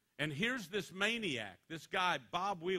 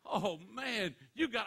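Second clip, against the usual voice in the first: mock envious buddy; 7 semitones higher, 4 dB louder, slower than usual. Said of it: "He goes".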